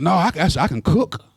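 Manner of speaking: high, indignant, rising protest; hammed-up boastful younger self; stubborn young man. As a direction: insist you need nobody. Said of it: "I said".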